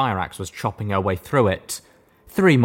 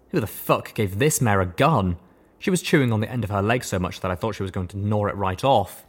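ordinary delivery, nothing marked

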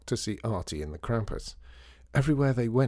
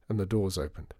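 ordinary delivery

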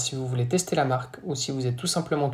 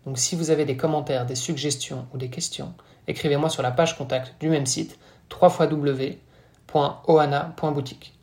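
ordinary talk

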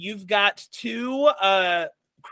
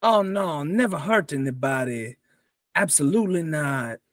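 Pink Panther voice; 2 semitones lower than usual. Acted. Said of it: "You sound just like the Pink Panther".

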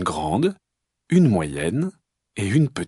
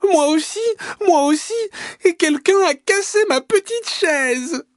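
put-on very high, louder baby bear squeal; tiny voice crying out; 4 dB louder than usual. Baby bear over his broken chair, tiny shrieking voice. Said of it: "crie de sa toute petite voix".

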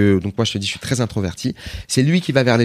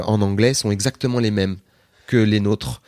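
usual speaking voice

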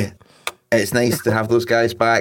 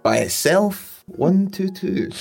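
slow drawn-out bingo call, higher; bingo caller; 8 semitones higher, slower than usual. Acted. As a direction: call the number like a bingo hall. Calling bingo numbers.

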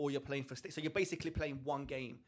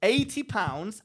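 louder, punched repeat; 6 semitones higher, 12 dB louder, much slower than usual. Real, not acted. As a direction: hammer the price home. Repeats price after interruption.

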